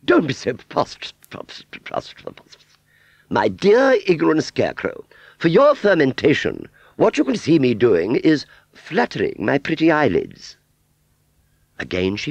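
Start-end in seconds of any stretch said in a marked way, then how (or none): 0.1-2.6 "pros-" sputtered repeatedly, fading into splutters; stumbling over "pros-". Acted. Aunt Sally attempting a big word, sputtering "pros-". 3.3-10.6 high, loud, affected grandeur; haughty fairground aunt. Acted. Aunt Sally explaining her eyelid fluttering, haughty.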